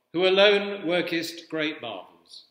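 ordinary talk, nothing marked

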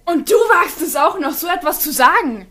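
how dare you, tell him off; indignant scolding. Acted. Scolding Kid for defending his symmetry, loud and indignant.

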